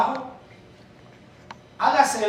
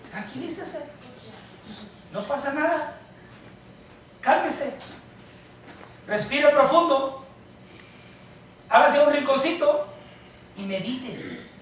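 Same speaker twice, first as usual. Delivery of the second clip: soothing calm; 3 semitones higher, 3 dB quieter than usual. Acted. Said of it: "pastor soothing congregation".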